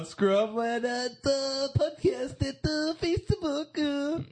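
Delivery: high, slow announcer plug; drawn-out plug. Acted. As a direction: stretch it out like an ad. Mock plug for the podcast.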